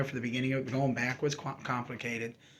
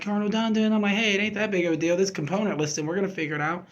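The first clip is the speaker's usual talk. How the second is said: breezy brush-off; 7 semitones higher, 8 dB louder, faster than usual. Retold or imitated. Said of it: "I was like, and I'll never forget".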